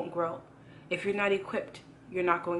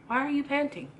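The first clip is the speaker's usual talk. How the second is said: higher, a raised urgent plea; God calling out to a tired child; pleading God voice; 7 semitones higher than usual. Retold or imitated. Preacher voicing God calling to the weary church, raised and pleading.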